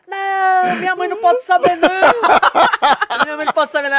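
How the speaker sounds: high, loud pretend sobbing plea; faked despairing plea. Kneeling prankster pleads to the crowd, fake despair. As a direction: beg as if your world just ended.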